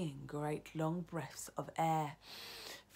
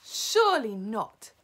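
slow, raised shout; shouted disbelief; 5 semitones higher, 12 dB louder, slower than usual. A narrator's reading. Other witches doubting her, shouting. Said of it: "the others shouted".

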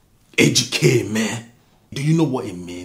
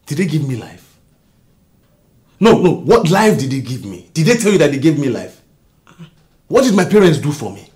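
louder, faster bitter rant; angry outburst; 3 semitones higher, 6 dB louder, faster than usual. Acted. Husband to wife about his parents, angry rant. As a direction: let the resentment boil over.

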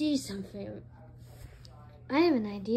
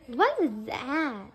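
low, drawn-out question; slow puzzlement; 4 semitones lower, slower than usual. Acted. Puzzling over a strange noise, slow low question. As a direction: stretch the question out slowly, lower. Same question, slower and lower.

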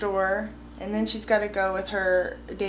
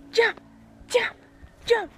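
yelling from the sidelines; 12 semitones higher than usual. Real, not acted. Cheering toddler chasing bubbles.

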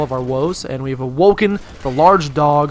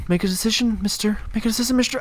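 higher, chiding voice at himself; mock scolding; 6 semitones higher, 4 dB quieter, faster than usual. Real, not acted. Gamer scolding himself to pick, mock-stern and higher. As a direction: scold yourself like a stern parent. Self-scolding over weapon choice.

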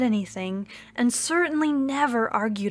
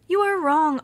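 higher, louder objection; island insists, raised voice; arguing; 6 semitones higher, 4 dB louder than usual. Acted. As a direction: push back hard. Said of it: "argued the island".

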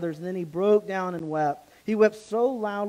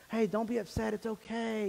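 a gentle, softer, higher hey, reassuring; mild comforting voice, softer and higher; 4 semitones higher, 5 dB quieter than usual. Retold or imitated. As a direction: soothe them like a brother forgiving. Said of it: "he's comforting them".

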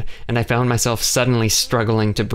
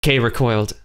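ordinary delivery, nothing marked

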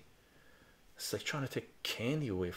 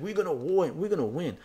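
higher, louder mock pub chant; imitating rowdy yahoos; 8 semitones higher, 6 dB louder than usual. Drunk yahoos boasting across a pub, mock rowdy. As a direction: put on a cocky crowd's boast.

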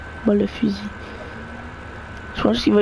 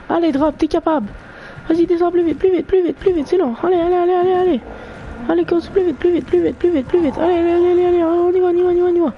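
excited urging; 9 semitones higher than usual. Real, not acted. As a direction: chant it on, faster, faster. Pousse le drop à descendre. (encouragement très excité et aigu)

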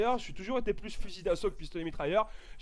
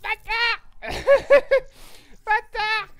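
much higher and louder yell with a laugh; excited shout; 13 semitones higher, 9 dB louder, much slower than usual. Real, not acted. Reacts to the drop.

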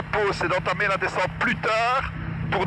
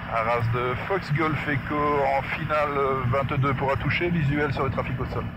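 radio call; 5 semitones lower than usual. Acted.

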